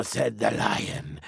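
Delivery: ordinary delivery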